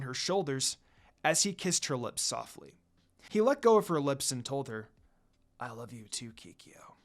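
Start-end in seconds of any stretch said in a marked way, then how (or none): 5.6-7.0 soft, breathy whisper of love; almost no voice on the name, tender; he whispers it close to her ear. Acted. Tender line to his lover.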